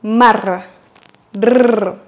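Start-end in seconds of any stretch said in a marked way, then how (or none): 1.4-2.0 long trilled Rrr; rolled R. Shown as a demonstration. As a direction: roll the tongue on Rrr. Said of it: "prononcer le R roulé".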